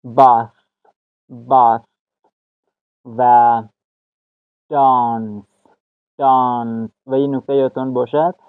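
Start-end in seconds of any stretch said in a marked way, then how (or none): 0.0-1.8 "باث" mit langem A, britisch gedehnt; "باث" deutlich gedehnt vorsprechen; model pronunciation. Shown as a demonstration. 4.7-6.9 "دانس" mit kurzem A; "دانس" knapp und deutlich vorsprechen; model pronunciation. Shown as a demonstration. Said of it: "در لهجهٔ امریکن اکسنت".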